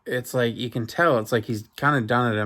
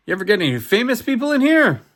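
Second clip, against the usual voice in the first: louder, higher, fast eager question; 11 semitones higher, 5 dB louder, faster than usual. Retold or imitated. Husband eagerly asks the barber about famous clients. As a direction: blurt it out keenly.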